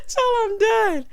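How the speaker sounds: very high, loud, helpless; real laughing squeal. Real, not acted. Host cracked up by the cartwheel, laughing squeal.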